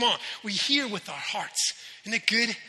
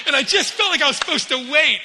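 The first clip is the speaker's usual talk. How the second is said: usual speaking voice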